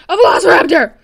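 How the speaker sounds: loud, high burst of excitement; excited shout. Real, not acted. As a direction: shout it out, thrilled by the discovery. Thrilled at finding a raptor.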